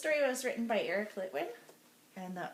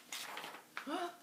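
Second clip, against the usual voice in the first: gasp; 6 semitones higher, 8 dB quieter than usual. Acted. Reacts to lost button.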